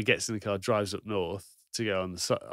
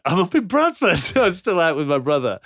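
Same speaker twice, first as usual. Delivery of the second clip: laughing confession; 8 semitones higher, 12 dB louder than usual. Retold or imitated. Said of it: "He's like".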